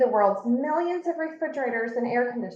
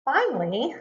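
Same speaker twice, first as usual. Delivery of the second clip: ordinary delivery, nothing marked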